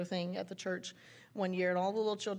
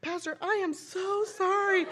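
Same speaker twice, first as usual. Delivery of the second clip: high, loud, drawn-out mock wail; mortified apology; 11 semitones higher, 6 dB louder than usual. Mom phoning the pastor to apologize, mortified. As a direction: imitate an embarrassed mother begging pardon. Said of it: "then she was mortified".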